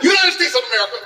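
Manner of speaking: big public pronouncement. Retold or imitated. Man announcing himself at the border, big public pronouncement. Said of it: "made this big public pronouncement".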